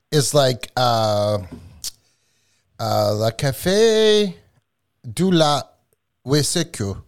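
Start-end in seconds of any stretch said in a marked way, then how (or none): 3.1-7.0 slow French-accented words. Real, not acted. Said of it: "your French accent".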